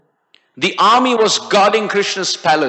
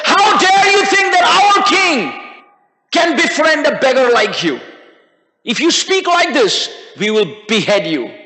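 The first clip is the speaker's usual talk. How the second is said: offended guards; 5 semitones higher than usual. A narrator's reading. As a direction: take offense and threaten the intruder. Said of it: "Now the security took offense".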